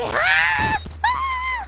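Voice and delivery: very high, loud mock shriek; two piercing put-on screams; imitated scream. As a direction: screech like someone going bonkers. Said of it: "It's like".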